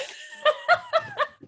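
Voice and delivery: shrill squealing laugh, way up high; high laughing shriek. Real, not acted. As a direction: let a delighted laugh fly out high. Thrilled about the champion.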